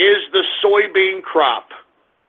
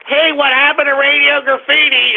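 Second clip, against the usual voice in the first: higher mock caller voice; mocked whining listeners; 6 semitones higher than usual. Host mimics listeners asking about missing segment, higher mocking voice. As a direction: imitate a complaining fan, pitched up. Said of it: "than you morons say".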